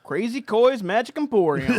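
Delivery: mock ad shout. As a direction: belt the store name like a TV ad. Mock shop advert.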